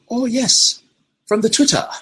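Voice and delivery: high South African accent impression of Trevor Noah; Trevor Noah's South African accent. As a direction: mimic Trevor Noah's South African lilt, pitched well up. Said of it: "a South African accent is not an accent you hear very often".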